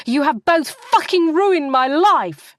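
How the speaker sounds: high, loud, slow furious scream. Acted. Kat screams at her sisters across the restaurant table. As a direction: scream it at them with everything.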